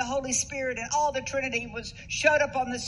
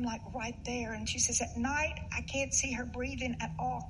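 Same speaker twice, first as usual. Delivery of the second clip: hushed worried quote; 7 dB quieter than usual. Retold or imitated. Friend fears mother dying.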